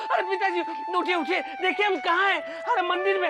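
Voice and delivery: high, urgent wake-up call; startled, high-pitched urgency; alarmed. Acted. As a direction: rouse your father in a panic. Alarmed, waking father in temple.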